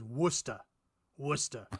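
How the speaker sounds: Worcester as "WUSS-tuh" in Massachusetts accent. Shown as a demonstration. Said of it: "Or if you're in Massachusetts".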